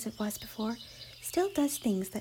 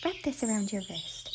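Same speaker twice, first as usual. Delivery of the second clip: ordinary delivery, nothing marked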